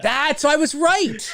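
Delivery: high, drawn-out triumphant cry; excited vindication. Real, not acted. Co-host hears confirmation of his guess, excited triumph. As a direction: burst out, thrilled to be proven right. Delighted at being proven right.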